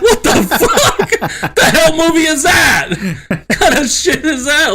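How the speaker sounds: loud, high, laughing outburst; incredulous yell. Retold or imitated. Son reacts to mom's spoiled ending, incredulous laughing shout. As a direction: yell it in comic disbelief, cracking up.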